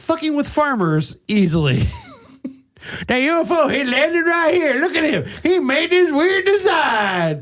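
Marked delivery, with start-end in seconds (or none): 3.1-7.4 astonished farmer. Acted. Acting out astonished farmer at crop circle.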